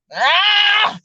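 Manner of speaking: high, drawn-out sigh of delight; blissful "ahh". Acted. Imagining crunching into a cream cheese bagel, blissful cry. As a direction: let out a high savoring "ahh".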